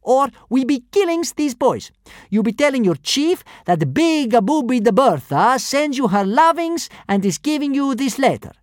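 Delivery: high loud brute warning. A narrator's reading. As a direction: threaten the class. First kidnapper continues threat.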